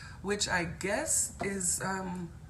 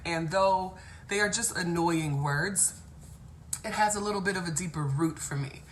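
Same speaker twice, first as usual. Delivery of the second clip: ordinary talk, nothing marked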